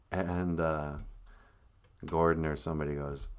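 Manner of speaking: usual speaking voice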